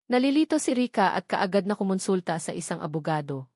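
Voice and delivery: ordinary delivery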